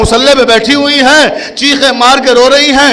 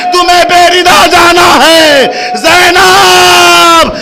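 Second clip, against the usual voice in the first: drawn-out, shouting marsiya chant; full-force mourning chant; wailing lament; 6 semitones higher, slower than usual. Acted. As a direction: cry each line out to the mourners. Climactic wailing recitation, Zahra to Zainab.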